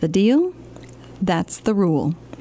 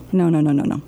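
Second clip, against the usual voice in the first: fast, lower panicked protest; hurried protest; 7 semitones lower, much faster than usual. Acted. Librarian dreads singing on the radio, fast protest. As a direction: rush it out in dread.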